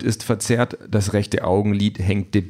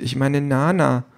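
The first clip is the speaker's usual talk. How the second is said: put-on higher, slow little-girl voice; child's voice; 6 semitones higher than usual. A narrator's reading. Three-year-old explains she means her nanny, childlike. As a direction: draw it out as a small child. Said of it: "sagt das Kind".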